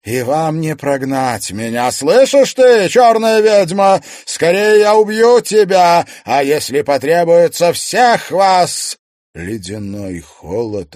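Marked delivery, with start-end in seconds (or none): 0.1-9.0 high, very loud raging shout; shrieked menace, easing slightly at the end; furious threat. A narrator's reading. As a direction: threaten them all at full volume. Spirit threatens to kill the priestess.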